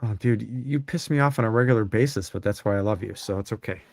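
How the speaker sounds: usual speaking voice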